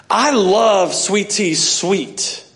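very slow, weighing each word; savouring the point about sweet tea; slow emphatic. Shown as a demonstration. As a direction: spell it out slowly. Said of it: "So watch".

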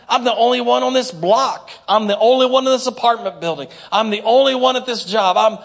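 hammed-up self-pitying prayer; whiny complaining prayer. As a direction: complain to God like a sulking child. Mock prayer of complaining believer.

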